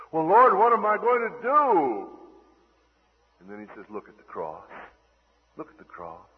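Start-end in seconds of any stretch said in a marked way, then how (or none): 0.1-2.2 loud, high cry for help; desperate plea. Acted. Acted desperate plea to God. 3.9-6.3 soft, low, hushed direction; gentle answer. Acted. Acted gentle divine answer.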